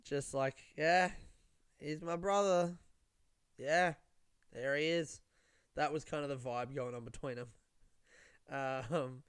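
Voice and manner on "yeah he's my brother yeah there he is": understated, drawn-out reserved dude; reserved brothers. Retold or imitated. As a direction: lightly mimic two laconic brothers. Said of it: "they're both pretty reserved dudes".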